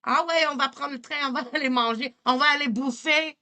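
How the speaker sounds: high, fast Quebec French accent; hammed-up Quebec French accent, laughing; accent québécois exagéré. Shown as a demonstration. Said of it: "That's Quebec".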